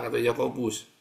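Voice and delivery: ordinary talk, nothing marked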